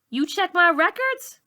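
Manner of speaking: outraged the teacher snooped; indignant surprise. A narrator's reading. Malika asks her teacher, indignant surprise.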